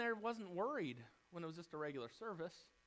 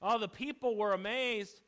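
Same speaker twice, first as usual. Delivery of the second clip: normal talking voice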